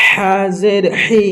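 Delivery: slow হাজের হি with a strong throaty h; big haa. Shown as a demonstration. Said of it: "বড় হা". (হালাক থেকে মোটা হাজের হি)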